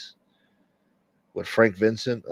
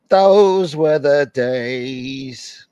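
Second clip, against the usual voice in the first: very slow, louder, drawn-out sigh of nostalgia; mock nostalgic drawl; 3 semitones higher, 5 dB louder, much slower than usual. Reminiscing to the chat, slow and hammed-up. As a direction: stretch it out like an old-timer.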